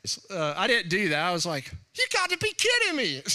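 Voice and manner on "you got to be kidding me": high mock disbelief, laughing it off; you've got to be kidding, pitched way up; incredulous. Retelling a pastor's challenge to fight, incredulous high voice.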